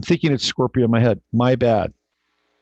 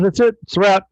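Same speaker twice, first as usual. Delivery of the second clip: Buddha declaring; 8 semitones higher, 4 dB louder than usual. Retold or imitated. Buddha voiced declaring the winner.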